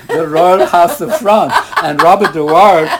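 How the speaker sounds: mock grandeur. Grand mock proclamation of noble names.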